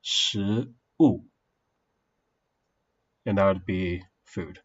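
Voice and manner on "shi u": Shi rising then u falling; Chinese tones. Shown as a demonstration. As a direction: give Shi and u their tones. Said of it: "first one and the third one".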